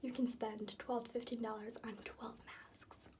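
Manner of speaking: soft breathy whisper; quiet hushed delivery; whispery voice. Real, not acted. Holds whisper in bath.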